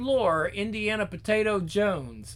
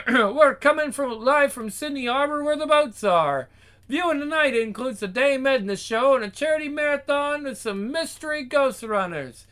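TV presenter retake; 6 semitones higher than usual. Acted. Presenter live from Sydney Harbor, high projected announcing. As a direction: announce it live, less rushed. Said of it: "it was a little too fast".

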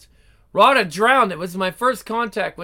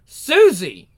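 angry shout; 3 semitones higher, 7 dB louder than usual. Acted. Jealous boyfriend catches her out.

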